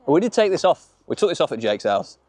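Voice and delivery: usual speaking voice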